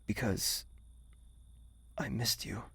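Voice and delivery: soft intimate whisper; whispered confession; tender whisper. Acted. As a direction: confide it softly.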